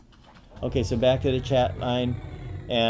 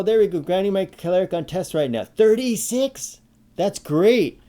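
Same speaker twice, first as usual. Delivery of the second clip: excited; 6 semitones higher, 5 dB louder than usual. Real, not acted. Keeps the excited raised voice.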